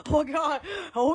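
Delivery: usual speaking voice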